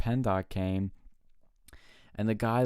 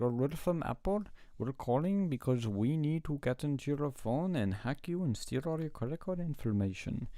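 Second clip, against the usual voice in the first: higher-pitched put-on scam caller voice; phone scammer impression; 5 semitones higher than usual. Fake Apple caller after your credit card, mock scammer voice. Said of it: "a few of them from India".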